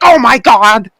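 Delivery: high, scandalised commentator cry; mock outrage from the announce desk; Michael Cole. Co-host mimics commentator if the Fiend did it, mock shock. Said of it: "He'd be like".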